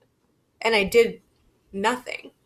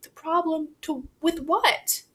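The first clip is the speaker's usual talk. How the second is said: baffled question; 8 semitones higher than usual. Retold or imitated. Baffled reply to mother.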